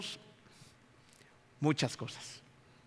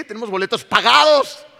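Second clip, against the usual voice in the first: louder, higher insistent demand; demanding payment; 6 semitones higher, 9 dB louder than usual. Retold or imitated. Traveller demanding his rights from airline staff, loud. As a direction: insist you paid, loudly. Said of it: "Exigiendo el pago".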